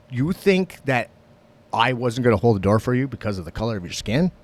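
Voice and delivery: loud, heated rant; boiling with rage. Retold or imitated. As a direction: retell a furious telling-off at full volume. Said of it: "I am just boiling with rage".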